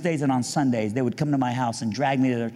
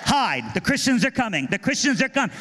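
higher, laughing mock alarm; mock panic; 11 semitones higher than usual. Teen mocking the youth group at his door, hammed-up panic. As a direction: cry out like the town's under siege, hammed-up.

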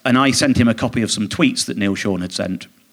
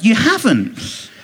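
much higher, slow disbelieving you haven't; mock incredulous retort; 9 semitones higher, much slower than usual. Reenacted incredulous reply.

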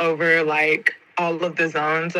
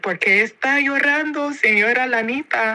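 kind driver; 8 semitones higher than usual. Retold or imitated. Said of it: "And he's like".

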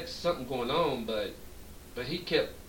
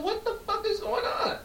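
much higher, incredulous cry; shocked outburst. Retold or imitated. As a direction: blurt it out in disbelief. Shocked at bedroom scene.

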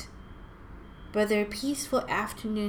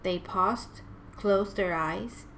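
ordinary delivery, nothing marked

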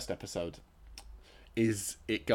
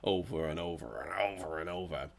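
hoarse, croaky throat; 3 semitones lower, 4 dB quieter than usual. Real, not acted. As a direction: talk through a croaky, sore voice. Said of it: "plus it's just my croaky ass voice".

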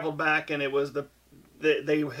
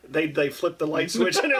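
normal talking voice

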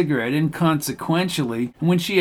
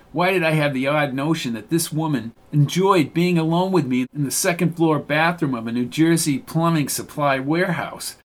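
plain reading voice

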